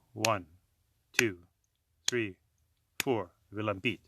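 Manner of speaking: slow, drawn-out counting to four. Shown as a demonstration. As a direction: count it slowly like a swaying trunk. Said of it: "you'll want a slow tempo".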